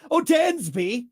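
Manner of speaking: put-on high, lilting posh British calling a boy. Host mocking the name Dansby in posh British accent. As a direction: call him like a posh British aunt. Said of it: "very posh, very British".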